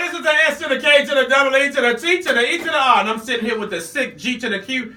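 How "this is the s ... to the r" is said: loud, fast, high hype shout. Real, not acted. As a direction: pump up the crowd. Radio host hype intro.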